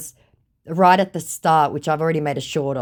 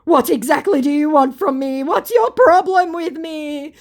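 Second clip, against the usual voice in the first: high, loud, whiny pleading; hammed-up wounded complaint; mock lament; 13 semitones higher, 6 dB louder than usual. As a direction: wail the questions, stretching the last one. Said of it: "laments my scammer".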